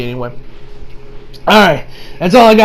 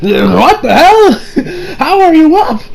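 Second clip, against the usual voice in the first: stunned disbelief; 9 semitones higher, much slower than usual. Retold or imitated. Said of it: "Ben's like".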